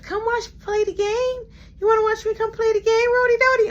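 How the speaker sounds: put-on sing-song little boy begging; high eager child voice; kid brother voice.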